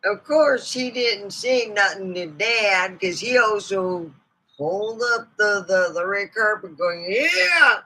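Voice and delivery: a hint of a chatty old lady telling family stories; mildly put-on folksy lady; snake lady voice. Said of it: "Let's bring out Miss Snakers herself".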